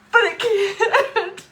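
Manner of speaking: slow, high and loud, breaking into tears; crying. Real, not acted. Daughter admitting she can't help, sobbing. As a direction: choke the words out slowly.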